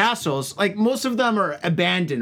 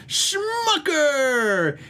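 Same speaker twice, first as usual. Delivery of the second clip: high, long-drawn product name; mock announcer; 8 semitones higher than usual. Host introducing the beer, drawn-out announcer voice.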